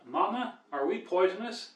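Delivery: put-on a tiny baby snake asking mama, small and high. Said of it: "the baby snake say to the mama snake".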